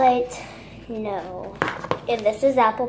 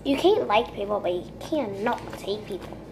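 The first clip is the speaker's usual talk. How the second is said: ordinary talk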